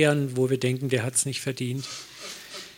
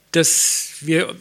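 usual speaking voice

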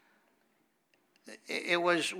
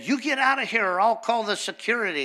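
high, louder angry order; 7 semitones higher, 4 dB louder, faster than usual. Retold or imitated. Witch ordering the preacher out, angry and high. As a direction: throw him out of the shop. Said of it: "She said".

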